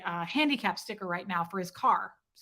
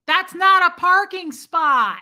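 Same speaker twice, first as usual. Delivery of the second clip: louder, higher, slow outraged point; indignant; 8 semitones higher, 11 dB louder, slower than usual. Real, not acted. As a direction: hammer home the outrage. Outraged at illegal parking.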